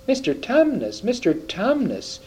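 high, pleading distress; acted distressed plea, swooping high; great distress. A narrator's reading. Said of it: "said Lucy in a great distress".

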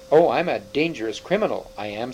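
usual speaking voice

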